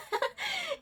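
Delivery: short high giggle; light real laugh. Real, not acted. Laughs at noise. (risadinha aguda)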